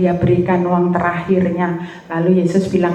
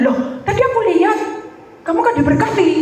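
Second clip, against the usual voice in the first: surprised; 13 semitones higher, much faster than usual. Retold or imitated. Retells Holy Spirit's challenge.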